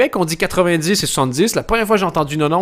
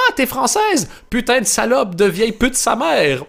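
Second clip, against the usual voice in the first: mock insult joyeux; 7 semitones higher than usual. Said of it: "Et ça fait autant plaisir de dire".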